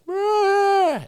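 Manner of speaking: very high, drawn-out mock whine; imitated dog whine. Hunter mimics his vocal dog in the blind, imitated whine. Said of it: "he's vocal and he whines".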